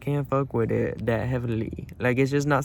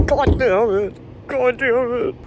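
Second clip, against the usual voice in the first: high, loud exasperated curse; loud frustrated outburst; frustrated cursing; 12 semitones higher, 5 dB louder, slower than usual. Real, not acted. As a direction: curse it out, pitched way up. Trunk will not open.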